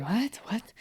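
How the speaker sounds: soft, high mock bewilderment; retold puzzled women. Women baffled by the man's apology, soft high puzzlement. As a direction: imitate baffled onlookers under the breath.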